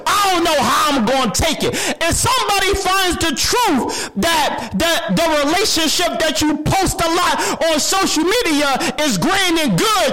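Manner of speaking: high, shouted panic of someone hiding; anguished hiding person. Acted. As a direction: cry out as someone terrified of being found out. Imagined person fearing exposure.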